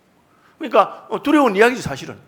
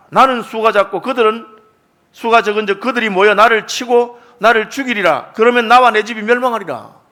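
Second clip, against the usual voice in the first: fearful Jacob's lament; 2 semitones higher, 7 dB louder, slower than usual. Retold or imitated. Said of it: "이렇게 말하거든요".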